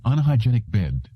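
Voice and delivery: ordinary delivery, nothing marked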